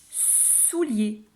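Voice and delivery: "Soulier" with a long hissed s at the start; stretched first sound. Shown as a demonstration. Teacher testing a word for the sh sound, stretched first consonant. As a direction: hold the s of "Soulier", then finish the word. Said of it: "je n'entends pas le chat".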